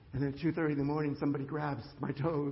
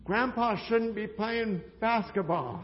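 loud, higher call across the gym; retold shout; 6 semitones higher, 7 dB louder, slower than usual. Daughter calls out to grandpa on the court, shouting. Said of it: "Tammy shouts across the thing".

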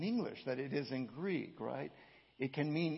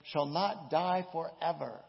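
loud proclamation; 3 semitones higher, 6 dB louder than usual. Shown as a demonstration. Preacher gives the literal reading, loud and slow. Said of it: "Literally, it says".

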